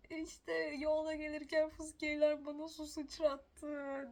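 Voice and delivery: slow, loud, high mock sob of a childish complaint; half-playful crying replay; retold crying. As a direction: wail it like a kid who got splashed. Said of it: "ağlamıştım".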